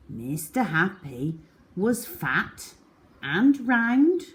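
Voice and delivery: ordinary delivery, nothing marked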